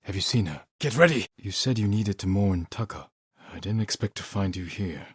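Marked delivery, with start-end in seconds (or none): 0.8-1.3 higher, louder battle warning; rally them, sharp and urgent; shouted call to arms. Acted. Warning ally before a fight.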